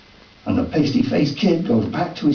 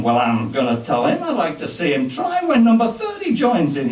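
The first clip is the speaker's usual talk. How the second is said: ordinary talk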